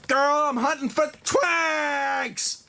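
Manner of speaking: very slow, stretched sultry line; drawn-out purr. Acted. Veronica replying to Scarlet's message, slow drawled tease. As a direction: stretch every word out teasingly.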